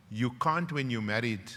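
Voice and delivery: ordinary talk, nothing marked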